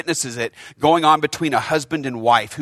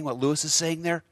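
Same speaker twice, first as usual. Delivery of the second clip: ordinary delivery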